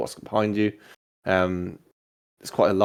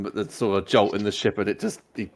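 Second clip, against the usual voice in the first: ordinary talk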